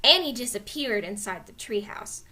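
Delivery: plain reading voice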